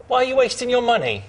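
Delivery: very high-pitched mock British accent; horrible British accent. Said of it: "that's my horrible British accent".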